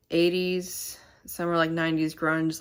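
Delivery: normal talking voice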